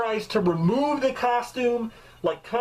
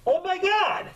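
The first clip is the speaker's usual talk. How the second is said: mocking onlooker; 10 semitones higher than usual. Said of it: "Anybody looking at me being like".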